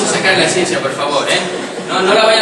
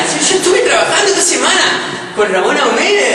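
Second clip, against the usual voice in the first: laughing; 8 semitones higher, faster than usual. Acted. Comic artist persona, laughing.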